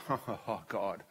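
soft, low laugh to God; hushed laughing awe, gently acted; laugh of wonder. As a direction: re-enact a quiet chuckle of amazement. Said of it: "I just laugh in wonder".

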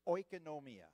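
"oikonomia" called out loudly for repeating; model pronunciation. Shown as a demonstration. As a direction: say "oikonomia" as a loud teaching model. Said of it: "You can say this".

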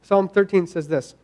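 ordinary talk, nothing marked

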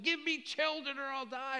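high, loud anguished plea; desperate Rachel. Acted. Wife pleading with her husband for children, anguished and high. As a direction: beg him like your life depends on it. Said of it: "You can just hear the angst in her voice".

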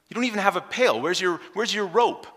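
incredulous. Pretend. Woman mocks Jesus' lack of tools.

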